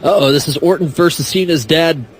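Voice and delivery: ordinary talk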